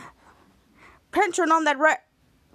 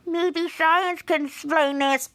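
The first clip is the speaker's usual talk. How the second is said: slow, squeaky put-on voice; high-pitched voice; slower than usual. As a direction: draw it out in a silly high voice. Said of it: "says in a high-pitched voice".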